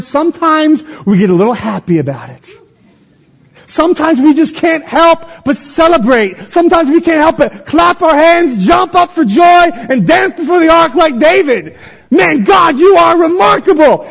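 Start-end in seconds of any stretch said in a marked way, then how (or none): 3.6-14.1 high-pitched, full-throated celebration; jubilant preaching. Real, not acted. Preacher urging church to celebrate God, jubilant shouting. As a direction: shout it out, overflowing with joy.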